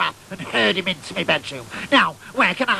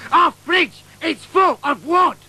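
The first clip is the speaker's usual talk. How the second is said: high, loud put-on mother voice; hammed-up outraged mum; indignant mum; 9 semitones higher, 4 dB louder, slower than usual. Mother telling son the fridge is full of wood, outraged.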